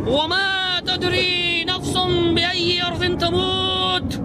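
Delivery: slow, high, ringing Arabic recitation; Quran recitation. Real, not acted. Debater proving his point from scripture, solemn recitation. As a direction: draw the verse out in a sustained chant.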